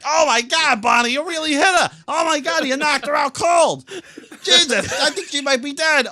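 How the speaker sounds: high, loud panicked cartoon shouting; horrified Fred. Fred panics after Barney hits Betty, high and loud. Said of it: "Fred's horrified".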